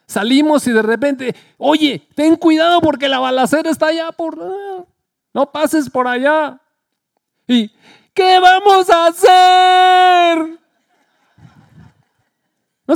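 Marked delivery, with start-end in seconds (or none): 1.6-6.6 raised, slowed-down warning about a shootout; a neighbour urging caution, pitched up; anxious warning. Acted. Modern neighbour warns of shooting. 8.1-10.6 high, loud, very slow wail of a question; panicked cry. Acted. Frightened man asks what to do, loud and high.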